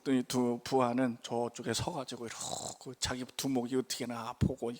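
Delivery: ordinary talk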